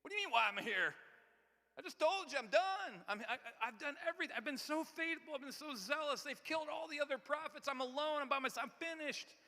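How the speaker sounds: exhausted prophet venting his frustration at God; Elijah going off. Acted. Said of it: "Elijah goes off again".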